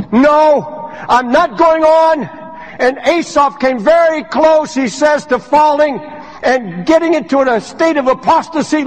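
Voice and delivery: high, driving preacher voice, building; full-force preaching. Acted. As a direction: hammer the point home to the congregation. Rising sermon climax.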